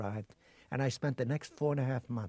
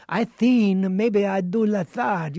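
higher, louder, slow cliché Mexican accent; 7 semitones higher, 11 dB louder, slower than usual. Shown as a demonstration. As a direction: ham up the stock Mexican accent slowly. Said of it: "I didn't do the, the cliche of".

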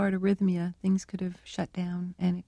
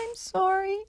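much higher tiny pathetic parrot voice; pathetic little voice; 12 semitones higher than usual. Retold or imitated. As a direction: squeak "I'm sorry" like a guilty little bird begging forgiveness. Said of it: "in this pathetic little voice".

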